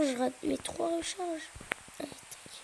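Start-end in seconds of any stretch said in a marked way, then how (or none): none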